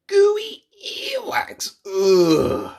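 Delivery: very high, very slow gooey voice; gooey disgust. Acted. Reader savouring gross words for children.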